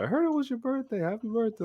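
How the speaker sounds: sing-song the greeting; sweet manager voice. Retold or imitated. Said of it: "So she said".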